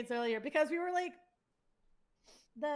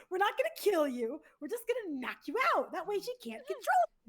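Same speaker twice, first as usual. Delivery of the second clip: high, raised-voice comic reassurance; mock reassurance; 7 semitones higher, 5 dB louder than usual. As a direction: insist brightly that it's for their own good. Reassuring a begging victim, retold.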